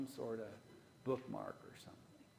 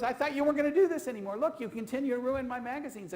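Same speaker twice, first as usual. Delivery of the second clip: loud, high, fast exasperated complaint; exasperated scolding; 10 semitones higher, 12 dB louder, faster than usual. Retold or imitated. Wife scolding husband over dog-eared magazines, loud and exasperated. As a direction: scold him like a fed-up wife.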